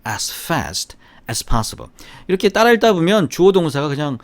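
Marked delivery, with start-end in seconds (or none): 0.1-1.9 very slow, every word drawn out; teacher's slow repeat-after-me pace; slow model reading. Shown as a demonstration. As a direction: read it slowly for students to repeat. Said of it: "시작".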